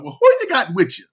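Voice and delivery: imitating an aunt's curious greeting; lightly voiced aunt. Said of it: "she was like".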